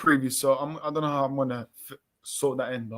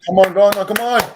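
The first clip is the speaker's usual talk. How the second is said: cheering; 5 semitones higher, 9 dB louder than usual. Real, not acted. Cheering Ghana on.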